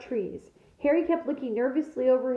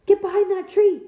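sudden louder, higher command; urgent order; 8 semitones higher, 7 dB louder than usual. A narrator's reading. Hagrid ordering the children behind a tree, urgent shout. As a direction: bark it out as danger nears.

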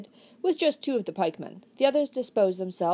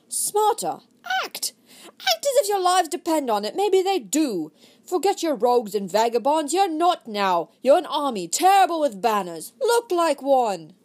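high, loud rallying cry to the troops; rousing shout; 6 semitones higher, 5 dB louder than usual. Acted. Desmond driving his actors to look like soldiers, loud rallying shout. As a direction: whip the actors into an army, shouting.

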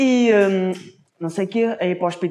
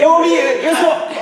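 excited shout; 8 semitones higher, 4 dB louder, much slower than usual. Retold or imitated. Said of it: "shouting the punchline high".

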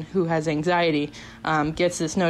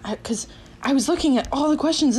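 usual speaking voice

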